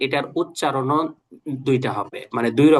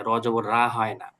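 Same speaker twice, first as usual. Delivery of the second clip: whispered wrong form; 2 semitones lower than usual. Shown as a demonstration. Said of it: "যখন জের জবর আমরা পড়বো". (ফিসফিস করে ভুল রা)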